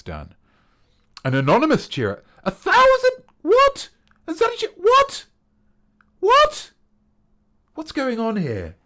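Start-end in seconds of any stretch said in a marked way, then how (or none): 2.4-8.8 very high, loud disbelieving cries; astonished. Real, not acted. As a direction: shriek out the shock at the huge cheer. A thousand bits cheered on stream.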